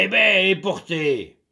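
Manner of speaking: loud, gruff demand; growling anger. Acted. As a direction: growl at her crossly. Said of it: "il grogna".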